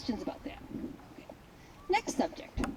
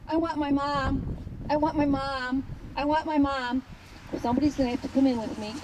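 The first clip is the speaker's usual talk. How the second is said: lightly voiced pleading pup; whiny scared dog; 2 semitones higher than usual. Dog running back to the gate, mild whine. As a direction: repeat it like a homesick puppy.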